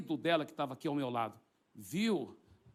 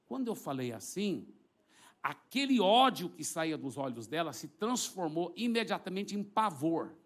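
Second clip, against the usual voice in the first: ordinary talk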